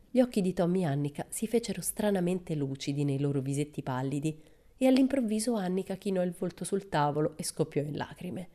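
usual speaking voice

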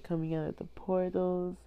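ordinary delivery

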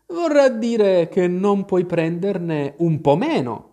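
stretched-out storybook Hatter; pedantic Hatter. A narrator's reading. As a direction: draw it out as a pointed correction. Hatter corrects Alice.

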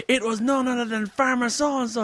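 high-pitched announcer to a crowd; mock dramatic reveal. Acting out announcement to audience.

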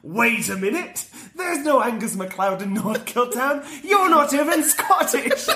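rising mock accusation, laughing; Homer accusing. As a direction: turn it back on him. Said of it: "Homer turns it on him".

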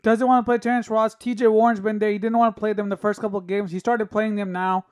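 ordinary talk, nothing marked

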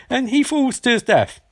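ordinary talk

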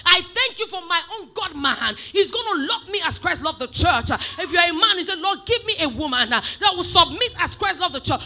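loud, emphatic praying aloud; fervent declaration prayer. Acted. Preacher modelling a prayer for a spouse, loud and fervent. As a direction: pray it as a forceful public confession.

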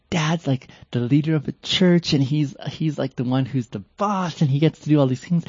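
a hint of a proud kid's voice; imitating admiring kids. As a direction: talk up dad like an impressed child. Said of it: "You know how kids can sometimes see things".

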